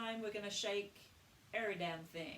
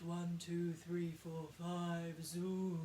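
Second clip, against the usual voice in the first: open chest counting; 6 semitones lower, much slower than usual. Shown as a demonstration. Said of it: "You can count in it".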